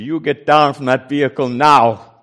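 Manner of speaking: loud barked order at gunpoint; retold holler. Soldier orders preacher off the vehicle, loud holler. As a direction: yell it as a soldier with a gun would. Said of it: "And she hollered at me".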